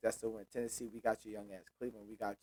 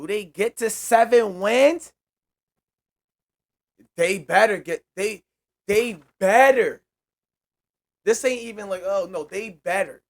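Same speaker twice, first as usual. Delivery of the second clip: loud, high, emphatic outburst; heated insistence; 8 semitones higher, 12 dB louder than usual. Real, not acted. Fan insisting the team had better reach seven wins, heated. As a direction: demand it loudly, stretching key words.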